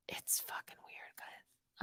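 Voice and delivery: slow whispered aside; real hushed confession. Real, not acted. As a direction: lean in and whisper it. Confiding aside to viewers.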